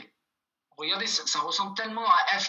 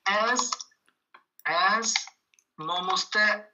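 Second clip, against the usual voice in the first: each word set apart with pauses, louder; slow dictation; 4 semitones higher, 5 dB louder, slower than usual. Shown as a demonstration. Teacher dictating a sentence for the students to copy, word by word. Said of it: "qui vous demande".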